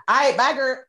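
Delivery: sassy goodbye. Retold or imitated. Rangers leaving the monkeys, sassy goodbye. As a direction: fling a breezy bye girl. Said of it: "ceremoniously like".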